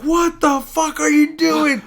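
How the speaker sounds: high, loud, drawn-out yell; crowd's outraged disbelief. Retold or imitated. Spectators reacting as wrestler releases opponent, outraged yell. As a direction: stretch it out slowly in loud disbelief. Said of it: "everyone's like".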